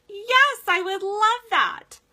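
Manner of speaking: high, loud and slow, beaming; smiling eager yes. Retold or imitated. Woman with a Yorkie happily accepts free treats, beaming and eager. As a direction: gush a delighted yes to a stranger. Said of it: "She smiled and was like".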